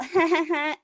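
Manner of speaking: high imitated "ha-ha-ha" giggle; mocking little laugh. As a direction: mimic their taunting titter. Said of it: "they do the little".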